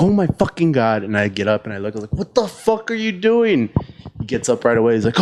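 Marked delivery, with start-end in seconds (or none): 0.0-1.0 high-pitched retold shock; shocked outcry. Imitates girl discovering the man watching. 2.2-3.7 high, drawn-out outraged demand; pissed off. Retold or imitated. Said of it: "I was, like, so fucking pissed off".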